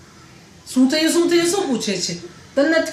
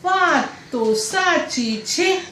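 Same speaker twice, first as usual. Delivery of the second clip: higher, very slow mock agreement; mother-in-law agreeing; 6 semitones higher, much slower than usual. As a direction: stretch it out, smug in-law siding with him. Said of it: "પછી મારા સાસુ કે".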